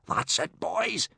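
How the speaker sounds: high, pleased encouragement; delighted. A narrator's reading. Gobber praising the boys' careful riding, delighted. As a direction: praise them with rising delight. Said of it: "said Gobber delightedly".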